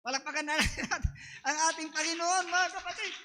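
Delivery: laughing. Real, not acted. Laughing while calling applause.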